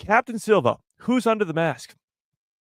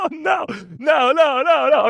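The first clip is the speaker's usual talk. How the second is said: mock manic; 11 semitones higher, 4 dB louder, slower than usual. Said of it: "Mike's already lost it".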